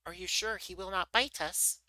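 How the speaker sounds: high little mouse voice, mildly timid. A narrator's reading. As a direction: ask with a touch of worry, pitched high. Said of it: "in a timid voice".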